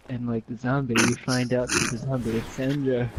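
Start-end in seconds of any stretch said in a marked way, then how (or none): none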